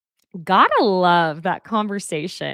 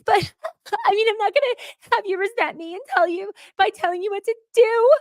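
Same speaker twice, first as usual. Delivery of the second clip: high, far higher giggling voice; giggly Andrea impression; 13 semitones higher, faster than usual. Andrea laughing off Rob's offer, high giggling. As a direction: imitate her laughing through it. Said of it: "she's like".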